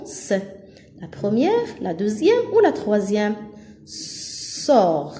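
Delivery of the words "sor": "Sors" as a lone syllable, sor, with a long initial s; drawn-out S. Shown as a demonstration. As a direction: hold the hiss at the start of "Sors". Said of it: "j'entends S". (« Sors » avec le son s allongé)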